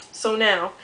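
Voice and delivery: ordinary delivery, nothing marked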